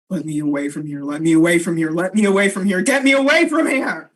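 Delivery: low growl building to a loud roar; frantic beast. Acted. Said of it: "the beast is just saying".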